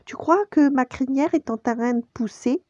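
high, hopeful little cub voice; young Simba. A narrator's reading. Cub asking his friend about his roar, high child voice. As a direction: ask in a small high child's voice. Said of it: "demanda-t-il à Nala".

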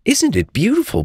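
high, thrilled little tiger; high-pitched storybook delight; delighted cry. A narrator's reading. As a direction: share the wonder in a high voice. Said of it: "cried Little Tiger".

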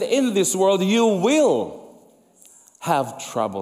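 slow, weighted "will", falling hard on "have trouble"; stress on will. Shown as a demonstration. Preacher stressing "will" to the congregation, slow and firm. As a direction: lean on "will" to make it certain. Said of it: "There is a guarantee".

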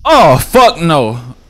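drawn-out startled shout; loud slow cry. Real, not acted. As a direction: yell it slowly in shock. Horror jump scare reaction.

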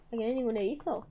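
ordinary talk

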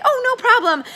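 high bright oh no problem; breezy and sunny, no pressure at all; cheery reply. Shown as a demonstration. Consultant answering friend's polite no, bright and high. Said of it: "And then you say".

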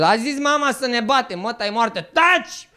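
put-on high, loud, slow drunken rant; loud, high, slurred yell; drunk Ștefan yelling. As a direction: bark at the soldiers like a drunk king. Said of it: "După aia s-a întors".

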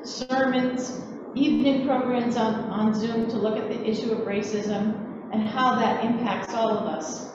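normal talking voice

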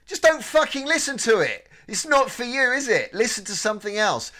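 high, loud, indignant outburst; exasperated rant. Acted. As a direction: tell off the haters, high and loud. Mock rant at hostile listeners.

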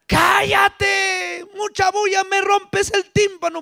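high-pitched scolding for noise; annoyed complaint. Acted. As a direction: complain as an irritated bystander. Bystander silencing the shouting beggar.

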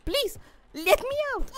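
very high, squeaky begging; playful pleading. Pretend. Player stuck in the cat begs to get out, playful pleading. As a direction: plead in a high mock-desperate voice.

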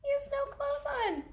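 high, quick mock-shocked aside; mock scandalised. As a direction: tell them like a giggly secret. Shocked comment.

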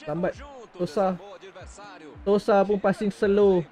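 ordinary talk

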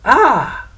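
high, loud ah of recognition; a sharp cry as the trick dawns; cry of realization. Acted. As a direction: prince cries out on seeing through the devil. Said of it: "cried Francis".

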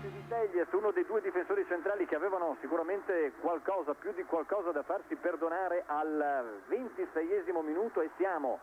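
ordinary talk, nothing marked

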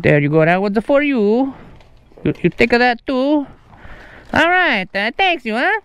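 high, lilting fake Italian accent. Prankster settling the tip with delivery person, mock Italian accent. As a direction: play a fussy Italian customer, singsong. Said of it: "uh, an Italian accent".

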